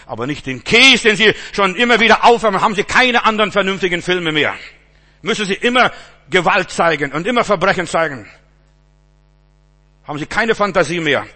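loud, quick, scolding sermon voice; indignant preaching. Acted. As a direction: rail against the films from the pulpit. Indignant preaching about films.

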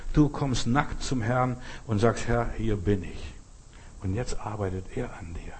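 ordinary delivery

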